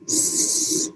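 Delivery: "S" as a long voiceless hiss; "S" hissed, not the letter name; isolated beginning sound. Shown as a demonstration. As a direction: hold the sound of "S" alone, softly. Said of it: "What's the beginning sound in sky?".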